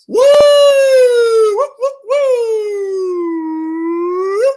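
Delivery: loud, very high whooping cheers; celebratory whoops. Real, not acted. Collector whooping over a rookie card pull, loud, high whoops. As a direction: whoop with real delight at the pull.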